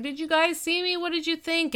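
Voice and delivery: high, eager questions; fishing for praise, excited. Retold or imitated. Eric eager after his broadcast.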